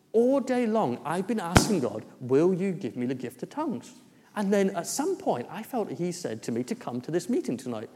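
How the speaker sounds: bursting, high-pitched eager retelling; excited retelling. Retold or imitated. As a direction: gush it out, pitch jumping up. Man retells his prayers excitedly.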